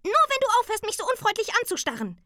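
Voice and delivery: very high creature retort, speeding up; schlagfertiges Tingeli. A narrator's reading. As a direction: fire back in a squeaky voice. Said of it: "entgegnete das Tingeli schlagfertig". (schlagfertig mit hoher Piepsstimme)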